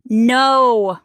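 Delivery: loud flat cry of refusal; wife shouting her husband down; shouted. Acted. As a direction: shout him down. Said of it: "I shouted".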